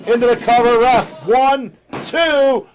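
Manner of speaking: rising excited count; lucha libre announcer. Real, not acted. Live play-by-play climax.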